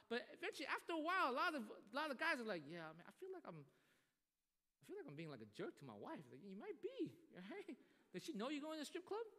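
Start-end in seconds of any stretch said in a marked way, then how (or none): 2.6-6.2 sheepish confession. Retold or imitated. Coworkers confessing guilt. 6.4-9.3 teasing question. Retold or imitated. Mentor teasing coworker.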